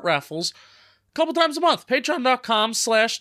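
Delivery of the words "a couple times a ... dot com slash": cracking voice mid-sentence. Real, not acted. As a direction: voice cracks up high. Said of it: "think my voice just cracked".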